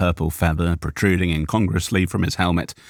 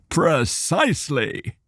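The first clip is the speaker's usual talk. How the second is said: drawn-out precise gentleman; 9 semitones higher, slower than usual. A narrator's reading. Cal Asser voiced.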